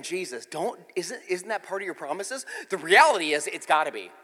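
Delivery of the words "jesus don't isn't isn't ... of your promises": quiet plea. Retold or imitated. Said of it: "we're like".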